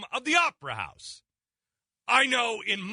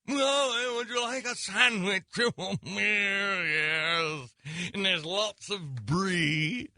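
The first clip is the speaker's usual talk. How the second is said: mock posh; 3 semitones higher, much slower than usual. Mocking opera snobs at food counter.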